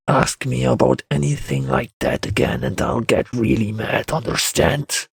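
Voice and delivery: slowing, threatening growl; growled warning. A narrator's reading. Man warns boy off the subject, growling. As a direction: warn him off with a growl. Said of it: "He growled before adding".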